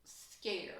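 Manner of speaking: whispered, dropping off after a long pause; a hushed, fearful scared. Real, not acted. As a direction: breathe the word scared. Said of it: "I was so".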